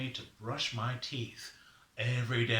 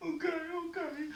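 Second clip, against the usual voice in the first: tearful agreement; faster than usual. Acted. Sufferer gives in to Raven's reassurance, high tearful whimper. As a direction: agree through put-on sobs. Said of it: "Don't cry".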